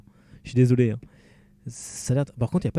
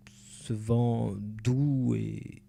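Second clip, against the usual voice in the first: drawn-out dreamy reading; much slower than usual. Acted. Dreamy poetic reading of game text.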